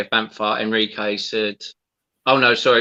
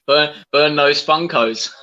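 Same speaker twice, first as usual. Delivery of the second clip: laughing; 5 semitones higher, 4 dB louder, slower than usual. Real, not acted. Laughing while reading a jab.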